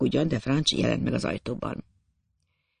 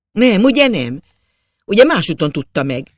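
higher and loud, begging it isn't true; alarmed cry; 4 semitones higher, 7 dB louder than usual. A narrator's reading. Hearing who killed his friend, a loud alarmed cry. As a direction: cry out in disbelief. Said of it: "Kiáltottam".

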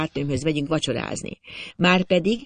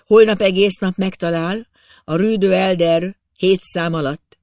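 superior; 5 semitones higher, 7 dB louder than usual. A narrator's reading. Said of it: "leírhatatlan felsőbbséggel".

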